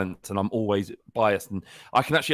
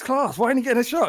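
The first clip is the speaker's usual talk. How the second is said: high-pitched mock outrage, getting louder; indignant disbelief; 14 semitones higher, 7 dB louder than usual. Arguing Brooks should get picked, indignant disbelief. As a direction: protest it like a baffled fan. Retold indignant questioning about player.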